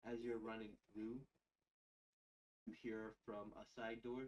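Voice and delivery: normal talking voice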